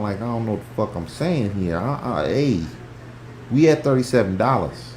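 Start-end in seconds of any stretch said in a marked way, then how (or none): none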